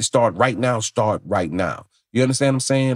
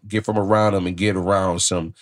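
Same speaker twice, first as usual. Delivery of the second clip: ordinary talk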